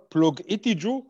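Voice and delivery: light put-on African accent. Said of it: "switch into my, my African accent".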